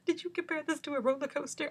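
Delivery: high put-on nurse voice; mimicking the nurse. The nurse asking about the roller coaster, in a high put-on voice.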